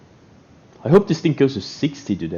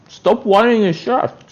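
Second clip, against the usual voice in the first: talking through a mouthful; chewing loudly between words; messy loud eating; 3 semitones higher than usual. Real, not acted. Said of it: "I'm gonna talk with my mouth full now".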